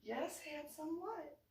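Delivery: lightly voiced doting mom, pitched high; lilting high mom voice; doting mother. Doting mother answers her son.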